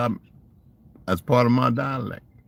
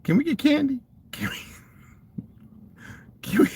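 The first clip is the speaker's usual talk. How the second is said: put-on high little-kid plea for candy, fading to a whisper with a giggle; begging child. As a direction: beg like a small kid, then trail off whispering. Kids begging elders for candy.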